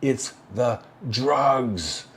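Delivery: slow, stretched it's the drugs; slow mock-solemn announcement; drawn-out emphasis. Shown as a demonstration. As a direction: drag out drugs for comic effect. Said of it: "I'm telling you, ladies and gentlemen".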